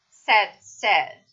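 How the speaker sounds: "said" with a short e, like "sed"; vowel becomes e. Shown as a demonstration. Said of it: "say diventa una E". ("said" pronunciato con la e breve, "sed")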